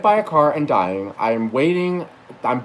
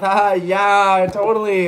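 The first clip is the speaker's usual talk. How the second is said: high, louder imitation of an amused reply; Sarah Silverman; 6 semitones higher, 4 dB louder, much slower than usual. Silverman answers the water guess, amused imitation in a higher voice. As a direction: imitate her amused agreement, pitched up. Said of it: "She starts laughing. She goes".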